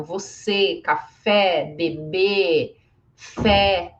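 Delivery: "você, bebê" closed, "café, fé" open; accented E. Shown as a demonstration. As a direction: stress the final E in each of "você, café, bebê, fé". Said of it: "vocês sabem que é som de E".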